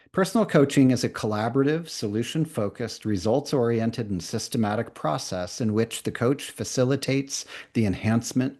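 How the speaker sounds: brisk read, rattled off in one go; all at once. Shown as a demonstration. Teacher reading the definition to trainees all at once. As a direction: read the definition straight through at speed. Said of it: "Here it is, all at once".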